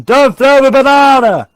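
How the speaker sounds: loud, drawn-out northern English shout; Christopher Eccleston impression. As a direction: exaggerate the northern accent, high and slow. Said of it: "Like your boy Christopher Eccleston".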